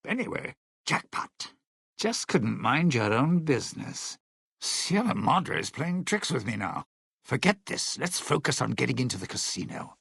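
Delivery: ordinary talk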